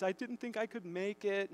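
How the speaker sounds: usual speaking voice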